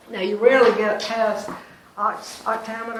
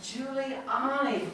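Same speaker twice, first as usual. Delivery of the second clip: slow, softer Giuliani, syllable by syllable; stress demonstration; 3 semitones higher, 6 dB quieter, slower than usual. Shown as a demonstration. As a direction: stretch out Giuliani to show its stress. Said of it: "where do you hear the stress in Giuliani".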